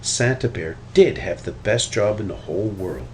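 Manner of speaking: usual speaking voice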